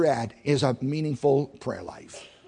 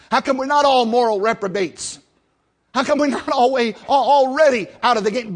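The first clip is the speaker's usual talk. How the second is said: raised, high-pitched preacher's voice; fervent preaching; 6 semitones higher, 6 dB louder than usual. Real, not acted. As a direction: drive it home to the congregation, loud and high. Preaches at full force.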